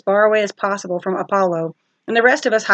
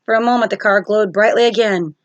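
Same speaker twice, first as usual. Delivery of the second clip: plain reading voice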